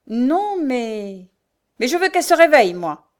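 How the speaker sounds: loud, high protest dropping at the end; angry insistence. A narrator's reading. Mother overrules her son's objection, angry. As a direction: insist furiously on getting your way.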